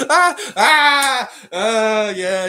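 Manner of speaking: high, loud, gasping laughter; helpless laughter. Real, not acted. Cracking up after a fan's joke, laughing hard. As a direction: laugh till it hurts.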